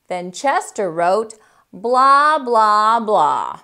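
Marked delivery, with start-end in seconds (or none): 1.7-3.6 bored, mocking blah blah blah. Cat mocking the author's apology note. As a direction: brush it all off like a rude cat. Chester scrawls a rude reply.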